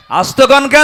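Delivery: loud rallying challenge; loud shout. Acted. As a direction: shout it back at the crowd.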